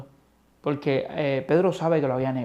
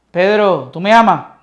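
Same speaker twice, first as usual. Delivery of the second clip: louder, pressing ask; urgent question; 4 semitones higher, 10 dB louder than usual. Acted. Christ pressing Peter a second time, loud and urgent. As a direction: press him for the truth.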